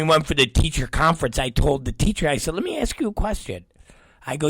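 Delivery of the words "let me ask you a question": high, quick, softened voice; feigned polite inquiry. Retold or imitated. Parent asks the teacher at conference, high quick polite voice. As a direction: lead in lightly and quickly.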